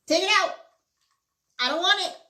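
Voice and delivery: high, loud hammed-up begging to the heavens; mock plea to God. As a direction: cry out to God in exaggerated desperation. Begging God to end periods.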